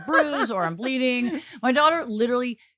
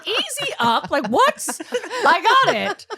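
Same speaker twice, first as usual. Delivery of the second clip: high, louder laughing disbelief; exasperated mom; 12 semitones higher, 6 dB louder, slower than usual. Retold or imitated. Mom reacts to daughter's blunt death talk, high and exasperated. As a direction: throw your hands up, half laughing. Mother reacting in retold scene.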